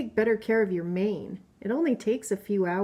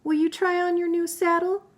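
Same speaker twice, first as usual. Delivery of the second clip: lively, higher voice for each storybook animal; playful book characters; 3 semitones higher, 4 dB louder than usual. Acted. Mother reading a picture book to her child, lively character voices. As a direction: read the animal friends' squabble with bounce.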